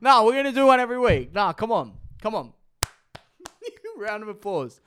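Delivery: high, fast and pumped; rally yourself, fired up; hyped up. Real, not acted. Hyped self-encouragement after outburst.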